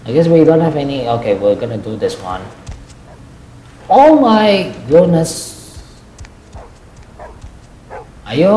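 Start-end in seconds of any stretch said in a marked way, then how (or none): 3.9-5.8 high, slow, drawn-out amazement; real astonishment, slow and high; astonished exclamation. Real, not acted. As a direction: stretch it out high, then let it fall. Reacts to the game.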